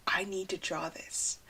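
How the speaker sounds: breathy whispered urge, barely voiced. Real, not acted. Alone in her car, seeing a selfie: whispered. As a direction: whisper it to yourself, struck by a selfie.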